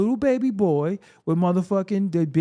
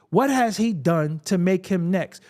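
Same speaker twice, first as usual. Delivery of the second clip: ordinary talk, nothing marked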